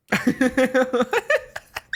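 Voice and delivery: cracks up at the punchline; real laughter breaking out. Real, not acted. Laughing at the billionaire claim.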